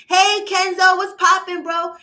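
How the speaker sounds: loud, high hype shout-out; excited greeting. Real, not acted. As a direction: call out to a viewer excitedly. Greeting a viewer by name.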